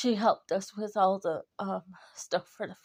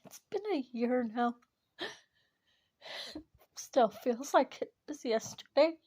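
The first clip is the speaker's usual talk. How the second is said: crying; 3 semitones higher than usual. Real, not acted. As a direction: voice breaking through tears. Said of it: "I'm crying".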